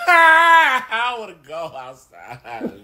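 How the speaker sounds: drawn-out, hammed-up wail; dramatic crying. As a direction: plead slowly through mock sobs. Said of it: "You're being a little dramatic".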